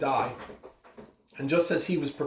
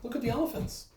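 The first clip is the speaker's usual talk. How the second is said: soft, higher coaxing aside; 6 semitones higher, 5 dB quieter, faster than usual. Real, not acted. Reader to child at the picture, softer and higher. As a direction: invite the little one to look. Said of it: "reader talks to child listener".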